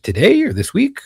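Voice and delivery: retelling a genuinely puzzled comeback; retold puzzled question. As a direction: ask it back as if it needs narrowing down. Deadpan retold clarifying question to wife.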